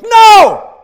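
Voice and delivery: loud, high triumphant shout of no; booming high no. Retold or imitated. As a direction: shout it like Paul refusing outright. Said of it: "he goes".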